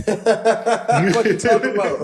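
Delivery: slow, high laughing disbelief. Real, not acted.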